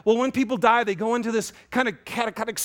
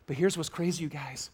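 whisper; 4 semitones lower, 5 dB quieter than usual. Real, not acted. Confiding aside to congregation.

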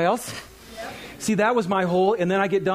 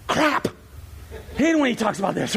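high, mock-annoyed grumble; mock groan; 4 semitones higher than usual. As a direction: play the churchgoer dreading the money talk. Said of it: "everybody's like".

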